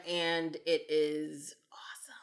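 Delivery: slow, whispered praise; hushed awe. Real, not acted. Host praising a movie they love, hushed. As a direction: drop to a slow whisper for emphasis.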